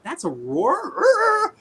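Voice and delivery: very high, long drawn-out roaring words; mock T-Rex. Podcaster voices T-Rex answering a lion, high drawn-out mock roar. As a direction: stretch it into a squealed dinosaur roar. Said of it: "the T-Rex is like".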